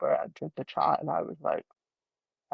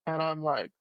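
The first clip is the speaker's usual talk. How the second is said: usual speaking voice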